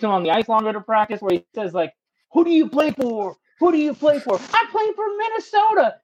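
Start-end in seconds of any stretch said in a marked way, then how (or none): 2.3-4.3 mock coach grilling. Coach grilling players on ice. 4.5-6.0 meek player answer. Retold or imitated.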